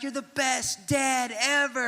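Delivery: high, stretched-out dream cheer; slow motion. Acted. Boys praising their dad in the dream, slow motion. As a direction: draw it out like a slow-motion film. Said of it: "it was all slow motion".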